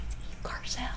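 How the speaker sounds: hushed, voiceless whisper; stage whisper under a phone call; whispered aside. Retold or imitated. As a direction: whisper it secretly to someone beside you. Mouths answer to husband during call.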